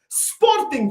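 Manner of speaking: "Sporting" thrown up high in disbelief; exasperated squeak on "Sporting"; incredulous high. Acted. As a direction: say "Sporting" with a high mocking yelp. Mocking the rival club's name.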